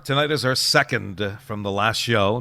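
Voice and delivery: usual speaking voice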